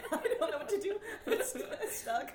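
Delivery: high, loud words through giggles; helpless laughter. Real, not acted. Guest caught with a chip over the salsa, laughing helplessly. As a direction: crack up so the words stick.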